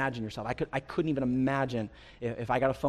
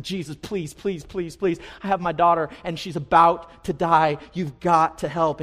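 retold pleading, louder toward the end; desperate begging; 4 semitones higher, 8 dB louder than usual. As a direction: plead with him, growing louder. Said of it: "begging, literally crying out".

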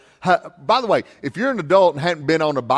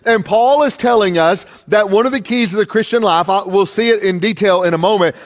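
raised, firm sermon delivery; preaching; 3 semitones higher, 4 dB louder than usual. Acted. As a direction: drive the point home from the pulpit. Preaches to the congregation.

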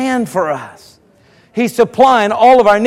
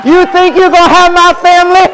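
high-pitched preacher's challenge; defiant taunt; 8 semitones higher, 6 dB louder than usual. Acted. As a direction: throw it in the devil's face. Taunting the devil while preaching.

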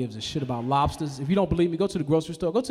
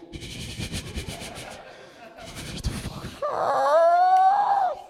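drawn-out shout; much slower than usual. Acted. Choking a chicken, telling it to hush, slow high shout. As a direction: stretch the shout out, high and silly. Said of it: "Why people shush people".

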